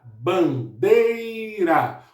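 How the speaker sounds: "bandeira" broken up slowly, DEI held long; stress on DEI. Shown as a demonstration. Teacher repeating it for pupils, long stressed DEI in "bandeira". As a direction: separate "ban", then stretch "dei" in "bandeira". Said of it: "O D é a sílaba mais forte".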